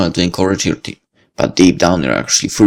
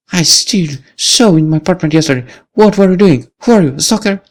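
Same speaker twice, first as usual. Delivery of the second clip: put-on higher, suspicious young woman; accusing Lucy; 10 semitones higher than usual. Lucy confronting the boy from her apartment, suspicious. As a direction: accuse him of stalking.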